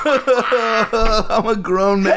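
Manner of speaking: squealing through helpless laughter; laughing. Real, not acted. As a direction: wheeze it out mid-laugh, slow and high. Hiccup laughing fit.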